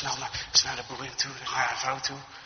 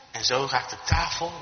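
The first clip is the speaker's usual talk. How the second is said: ordinary delivery, nothing marked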